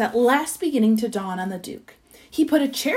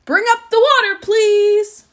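high, loud, long-carrying call; 10 semitones higher, 7 dB louder than usual. A narrator's reading. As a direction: holler up to someone high above. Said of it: "the Duke called out".

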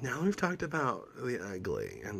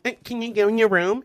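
higher and louder mocking "Eh, can you go in your room?"; exaggerated mock version, higher and louder; hammed-up mockery; 12 semitones higher, 7 dB louder than usual. Shown as a demonstration. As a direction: push the mockery further, up and loud. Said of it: "same energy".

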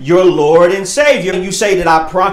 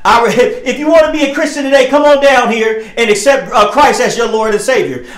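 ordinary talk, nothing marked

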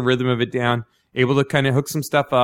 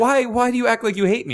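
whiny plea; 10 semitones higher than usual. Retold or imitated. His own complaint to boss quoted.

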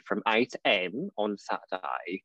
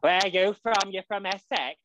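imitating a broad Derby accent, higher; a light take on a local Derby girl; hint of a Derby accent, pitched up; mock Derby accent; 8 semitones higher than usual. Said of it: "with the broadest Derby accent".

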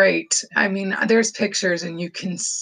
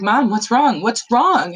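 worried; 5 semitones higher, 6 dB louder, slower than usual. Retold or imitated. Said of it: "She's like".

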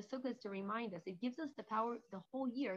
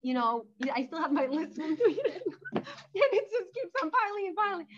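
laughing; 8 semitones higher, 10 dB louder than usual. Real, not acted.

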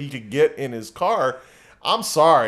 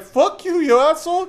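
high, loud imitation of her cursing; retelling the neighbor's fight in her high voice; mock screaming girlfriend; 11 semitones higher, 4 dB louder than usual. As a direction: shriek it like the angry girlfriend. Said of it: "she's like".

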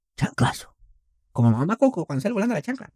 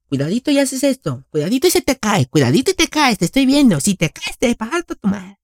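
mom voice; 8 semitones higher, 3 dB louder than usual. Retold or imitated. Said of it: "O cuando te decían".

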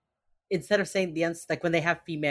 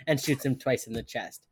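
usual speaking voice

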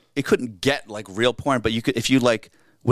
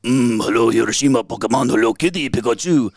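loud, hammed-up Japanese accent; exaggerated stage Japanese accent; mock Japanese accent; 6 dB louder than usual. Shown as a demonstration. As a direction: boom it out in a caricatured Japanese accent.

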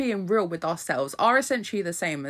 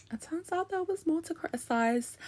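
much higher, softer, put-on squeak; high squeaky voice; 13 semitones higher, 5 dB quieter than usual. Shown as a demonstration. Repeats line in a put-on voice.

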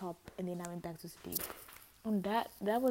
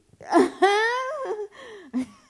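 high laugh; 14 dB louder than usual. Real, not acted. Laugh at own line.

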